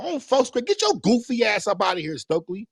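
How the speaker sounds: high mock heckling of Stokely, pitched well up; mocking heckle. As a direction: jeer like a fed-up fan in the seats. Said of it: "Stokely goofy ass come out".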